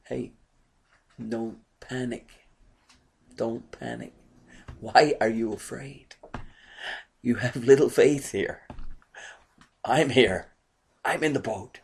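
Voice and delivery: soft, calming voice of Jesus in the storm; calming reassurance. Acted. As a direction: settle a frightened friend down quietly. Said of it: "I believe it was a calming statement".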